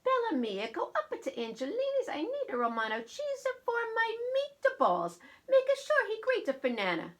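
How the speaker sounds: put-on high Italian-accented Nana giving orders; Italian grandmother voice, very high. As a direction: send the child shopping, in a thick Italian accent. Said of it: "will have an Italian accent".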